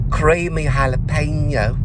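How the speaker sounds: high, slow imitation London English; London accent with slight twang. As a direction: mimic her London "Creamy jalapeno", stretched out high. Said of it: "is how she said".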